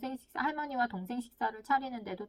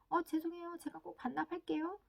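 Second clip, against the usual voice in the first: higher-pitched little voice saying sorry; apologetic child; 7 semitones higher, 3 dB quieter than usual. Acted. Child promises parent to return book, apologetic. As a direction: play a child hurrying to apologize. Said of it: "얼른 미안해하는 거죠".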